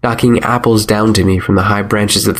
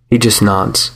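ordinary delivery

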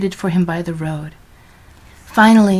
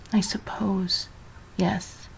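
soft whispered mumble; mumbled; 12 dB quieter, slower than usual. A narrator's reading. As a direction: barely voice the words. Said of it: "she mumbled".

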